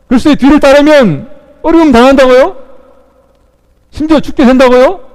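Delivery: high, loud incredulous protest; indignant rebuke. Acted. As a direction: challenge him with outraged rising questions. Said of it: "꾸짖고 있습니다".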